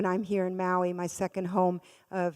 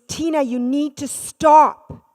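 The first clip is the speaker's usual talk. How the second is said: louder, slow, firm warning; nurse's firm order; 5 semitones higher, 10 dB louder, slower than usual. Retold or imitated. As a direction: lay down the law to a screaming patient. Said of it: "She goes".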